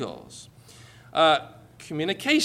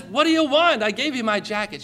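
high, baffled husband protesting; raised pitch, comic frustration; mock exasperation; 5 semitones higher, 3 dB louder than usual. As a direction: imitate a man at his wit's end. Acted quarrel of a couple.

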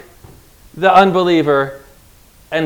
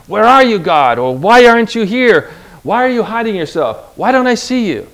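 high, louder cry of a doubting believer; pleading with God; 6 semitones higher, 4 dB louder than usual. Retold or imitated. Preacher voicing a doubting believer calling on God, pleading. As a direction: plead into the silence for God to show himself. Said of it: "believers at times can feel".

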